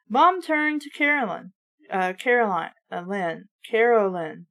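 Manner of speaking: ordinary delivery, nothing marked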